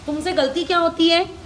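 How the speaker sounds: ordinary talk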